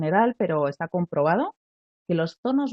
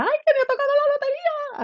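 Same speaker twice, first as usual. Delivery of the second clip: much higher, squealing cry of joy; shouted joy; 3 dB louder than usual. Shown as a demonstration. Teacher demos shrieking with joy over a lottery win. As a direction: scream the good news up high. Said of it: "a gritos".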